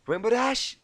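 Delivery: loud, high, exasperated calling; annoyed call. Acted. Applejack calls over the wall, annoyed. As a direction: shout it over the wall, irritated. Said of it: "began in an annoyed voice".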